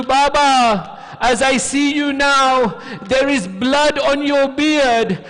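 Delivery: high, loud, drawn-out pleading; impassioned grief, building in pitch; anguished child's lament. Retold or imitated. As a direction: cry out to a lost father. Orphan child laments to father's head.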